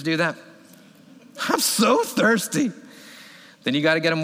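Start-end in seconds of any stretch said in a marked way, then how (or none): 1.5-2.7 put-on high, slow whining child voice; whiny kid. Dad imitating his daughter's thirsty whine at bedtime.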